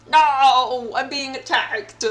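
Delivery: sudden loud alarm; alarmed cry. Real, not acted. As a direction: yelp as the attack lands. Attacked in the game.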